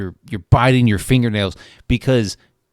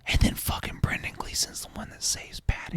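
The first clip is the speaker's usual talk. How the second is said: hushed, secretive aside; whisper; 13 dB quieter than usual. Real, not acted. Telling his co-host the twist in a whisper. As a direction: lean in and breathe it like a spoiler.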